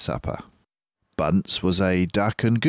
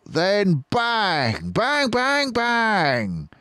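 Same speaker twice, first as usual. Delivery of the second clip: much higher sly schemer; Bean plotting; 12 semitones higher than usual. Acted. Bean laying out the ambush, high gleeful voice. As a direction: relish the shooting.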